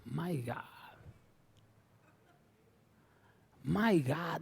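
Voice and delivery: hushed joking exclamation; soft comic aside; mock dismay. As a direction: murmur it in pretend disbelief. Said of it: "Respondiendo Jesús le dijo".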